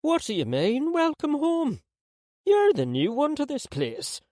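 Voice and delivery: put-on high, lively old lady voice; grandmother voice. A narrator's reading. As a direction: play the spry grandmother.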